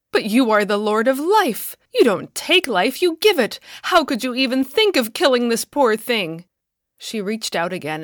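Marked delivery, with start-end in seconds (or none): 0.1-6.5 higher and louder, rising in protest; distressed pleading. A narrator's reading. Woman protesting to the Lord, loud desperate plea. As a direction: plead desperately for the poor thing.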